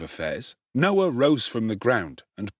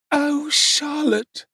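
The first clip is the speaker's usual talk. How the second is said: high, slow, out of breath; breathless; 4 dB louder, much slower than usual. A narrator's reading. Missus Sowerberry, drenched and fainting, gasps breathless. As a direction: gasp it out weakly. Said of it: "through a deficiency of breath".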